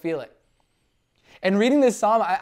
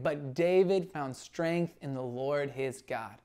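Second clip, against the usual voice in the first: slow, quiet, weighty emphasis; slow, hushed repetition of the key line; slow and soft; 3 semitones lower, 5 dB quieter, slower than usual. Shown as a demonstration. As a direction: let each word land softly. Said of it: "You catch that change?".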